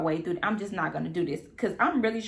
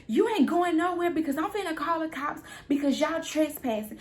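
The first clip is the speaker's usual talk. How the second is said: higher, threatening to call the cops; girlfriend's high angry threat; 7 semitones higher than usual. Retold or imitated. Girlfriend threatening the visitors with police, high angry. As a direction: snap back, pitched up. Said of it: "She said".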